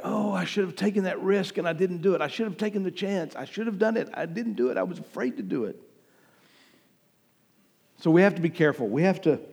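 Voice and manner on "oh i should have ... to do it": regretful. Acted. Preacher voicing those who didn't take the risk, hushed regret. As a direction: lament it softly, as if to yourself. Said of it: "living with that regret".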